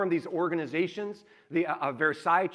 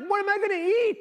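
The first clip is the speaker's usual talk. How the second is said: laughing disbelief; much faster than usual. Retold or imitated. Retelling shock at restrictive diet.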